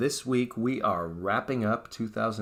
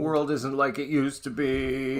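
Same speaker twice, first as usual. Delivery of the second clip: higher, drawn-out mock lament; mock depressed; 5 semitones higher, 3 dB louder than usual. Couple imitating themselves getting depressed together, mock lament. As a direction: wail it slowly, hamming up the gloom. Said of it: "sitting there getting depressed".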